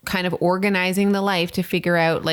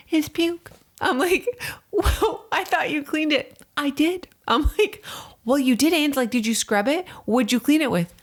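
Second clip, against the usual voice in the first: much higher, animated retelling of both sides; heated retelling; 9 semitones higher than usual. Retold or imitated. Wife reenacting the argument over the puke stains, high and heated. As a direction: replay the fight with rising disbelief.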